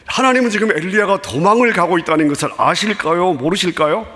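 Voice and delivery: usual speaking voice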